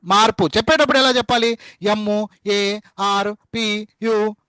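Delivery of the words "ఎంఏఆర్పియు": letter by letter. Shown as a demonstration. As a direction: spell out ఎంఏఆర్పియు very slowly, one letter at a time. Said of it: "అది కాదు, చెత్త అంటారు దాన్ని".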